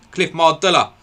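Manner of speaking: triumphant recall. Real, not acted. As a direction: shout Cliff Mardella in relief at remembering it. Finally recalls forgotten player name.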